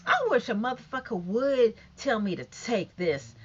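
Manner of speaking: ordinary delivery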